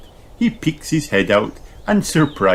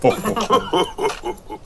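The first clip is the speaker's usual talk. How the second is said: laughter; 14 semitones higher than usual. A narrator's reading. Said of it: "family laughing".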